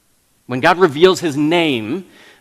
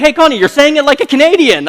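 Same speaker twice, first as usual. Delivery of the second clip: higher, louder gloating at his wife; gleeful gotcha; 11 semitones higher, 8 dB louder than usual. Retold or imitated. Husband gleefully teasing his wife Connie, loud and high. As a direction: crow it with delight. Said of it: "I was like".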